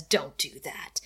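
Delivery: mock brooding vampire, hushed; quiet warning. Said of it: "Angel pulls the mayor off of Buffy and says".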